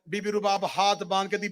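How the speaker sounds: ordinary delivery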